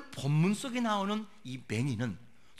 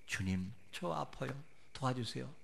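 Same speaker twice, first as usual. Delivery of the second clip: soft, low murmured plea; quiet prayer; 5 semitones lower, 10 dB quieter than usual. Retold or imitated. Hurting believer alone before God, praying quietly. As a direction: pray very softly and low. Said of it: "조용한 소리로 기도해도".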